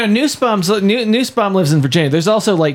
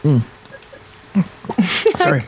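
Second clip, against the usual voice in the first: sipping water. Real, not acted. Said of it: "Caught Phone Boy sipping over here on the water bottle".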